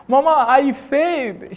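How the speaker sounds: put-on a high, cracked wail of a crying boy; a child sobbing out bad news; crying to mama. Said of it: "Why are you crying?".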